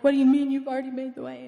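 quick, mildly baffled challenge; rushed, puzzled comeback; retold incredulity. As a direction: re-enact questioning God in disbelief. Retold incredulous reply to God.

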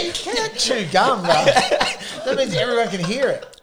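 slow, laughing telling-off; laughing at his gum chewing, slow and amused. Real, not acted. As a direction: scold him through laughter. Teasing mate about chewing gum.

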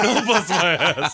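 laughing child imitation. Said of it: "I can hear him like".

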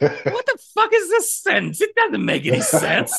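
laughing exasperation. Retold or imitated. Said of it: "And I'd say, like".

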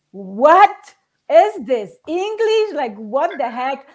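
retold confused outrage at the slang; baffled disbelief. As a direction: stretch it out, floored by slang. Her shocked reaction retold.

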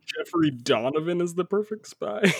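high-pitched disbelieving repeat; incredulous echo. Real, not acted. Host challenging co-host's pick for perfect spy, incredulous. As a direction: throw the claim back in disbelief.

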